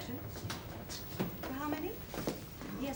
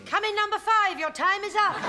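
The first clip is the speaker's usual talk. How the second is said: loud call across the water; shouting; 6 semitones higher, 5 dB louder than usual. Acted. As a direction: call out like a boat-hire man. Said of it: "came this voice shouting".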